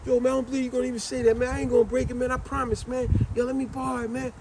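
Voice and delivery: high, fast wheedling plea; family member pleading. Retold or imitated. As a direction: rush the promise, begging. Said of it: "He said".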